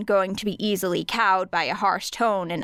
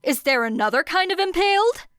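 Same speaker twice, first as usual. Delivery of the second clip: higher, sharp rising retort; snapped; 9 semitones higher, 4 dB louder than usual. Acted. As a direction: snap it back impatiently. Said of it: "Nemuri snapped".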